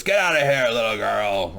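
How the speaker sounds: loud imitation of a sailor's brush-off; mock dismissive shout. Sailor sends Arya packing, mock dismissive shout. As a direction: shoo a child away in a put-on voice. Acts sailor sending girl away.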